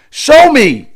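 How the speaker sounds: high-pitched, insistent challenge; emphatic demand. Real, not acted. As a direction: demand the proof, pitch jumping up. Demanding proof of her accusation.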